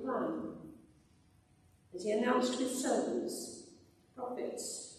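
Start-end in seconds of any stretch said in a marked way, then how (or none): none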